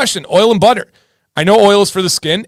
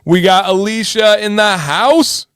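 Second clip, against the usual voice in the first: normal talking voice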